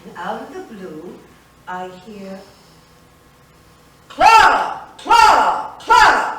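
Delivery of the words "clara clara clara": loud, high calling across the house; yelling her name. Retold or imitated. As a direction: bellow her name from another room. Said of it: "He was yelling already".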